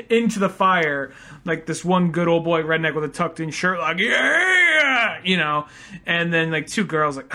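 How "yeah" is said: loud, high whooping yell; rowdy cheer. Retold or imitated. Redneck cheering at fire.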